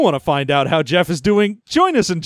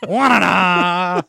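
drawn-out goofy wail, slow; silly cartoon wail; much slower than usual. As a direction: stretch it like a cartoon cry. Cartoon villain sound.

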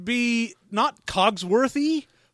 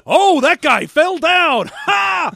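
slow, loud hammed-up shout, much higher; mock shout; 10 semitones higher, 8 dB louder, slower than usual. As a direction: bellow the non-joke with a fake laugh.

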